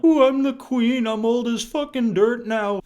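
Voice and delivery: high, slow, hammed-up British queen; mock-regal British falsetto, drawn out; bad British accent. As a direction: do a badly faked British old-lady voice. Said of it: "I can't do a British accent".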